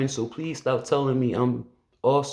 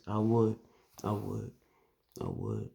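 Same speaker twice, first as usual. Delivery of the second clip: fading emotional; 3 semitones lower, 9 dB quieter than usual. Real, not acted.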